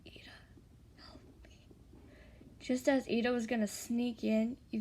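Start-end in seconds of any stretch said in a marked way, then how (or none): 0.1-1.5 barely audible whispered plea; very soft, voiceless cry for rescue. A narrator's reading. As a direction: whisper weakly for help. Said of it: "You start crying and whisper".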